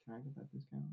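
very soft, small-voiced imitation of asking; timid retold request. Friend re-enacting asking for a tattoo discount, timid and hushed. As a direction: ask it very quietly, like a shy favor.

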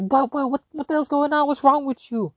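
high, startled dad voice; a panicked father asking what's wrong; mock-alarmed dad, pitched high. Dad reacting to yelling.

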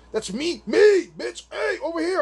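high, loud call for attention; shouting over the crowd to be picked; eager shout. Retold or imitated. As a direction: wave them over, yelling me me. Calling out, hand up.

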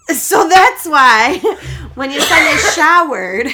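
high, loud, breaking into laughter; real laughing. Real, not acted. Friend revealing why the mirror said Mom, cracking up. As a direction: barely get the words out for laughing.

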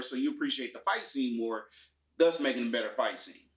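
ordinary talk, nothing marked

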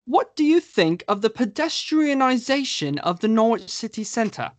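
high, put-on British accent read of a fan question; posh-sounding British voice, pitched well up. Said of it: "I have to do my British accent".

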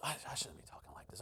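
whispery, stumbling mock apology; sheepish apology. Flustered co-worker apologising to preacher, sheepish. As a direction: mutter it almost in a whisper.

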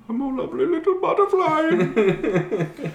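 high, slow, squeaky character voice. Retold or imitated. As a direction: put on a tiny high voice. Quoting movie character line.

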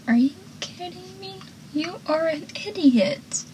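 high, hushed disbelief; mock exasperation. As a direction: squeak out an incredulous put-down, softly. Voicing Joey's exasperated retort to Pacey.

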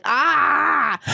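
high, acted cry of pain; mock pained cry. Performer retelling pain on a porn shoot, mock pained cry. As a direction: cry out as if hurt, playing it up.

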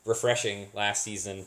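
usual speaking voice

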